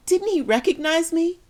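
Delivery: high and loud, voice cracking upward; anguished cry. Acted. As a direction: cry it out in disbelief. Mother's anguished cry at the cross.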